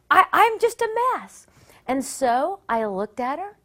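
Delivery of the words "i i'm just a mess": high, loud mock wail; imitating a frazzled outburst; panicked. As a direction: blurt it out in despair. Panicked thought retold.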